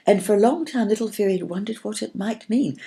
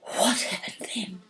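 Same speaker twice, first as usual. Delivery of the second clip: soft, slow breathed question; 4 semitones higher, 6 dB quieter, slower than usual. A narrator's reading. Arwen breathlessly asking the storyteller, soft and slow. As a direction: hold your breath as you ask. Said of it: "breathed Arwen".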